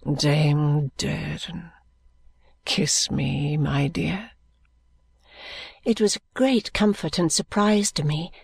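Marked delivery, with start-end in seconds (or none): 0.1-4.3 low, faint and slow; a dying man's fading whisper; weak voice. A narrator's reading. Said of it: "he said in a weak voice".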